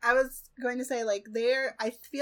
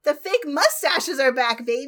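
gleeful proclamation; 4 semitones higher, 12 dB louder than usual. Real, not acted. Co-host announces the mustaches' return to fellow fans, gleeful proclamation. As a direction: crow it like a big comeback announcement.

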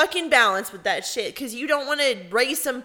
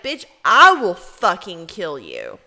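louder, slow threat thrown back; threat shouted back; 3 semitones lower, 8 dB louder, slower than usual. Retold or imitated. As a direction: top her threat, louder and slower. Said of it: "And I was like".

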